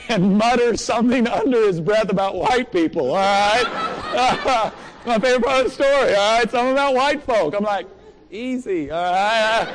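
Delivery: high, loud, laughing through the words; laughing excitedly. Real, not acted. Telling the congregation his favorite part, laughing. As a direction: tell it bubbling over with laughter.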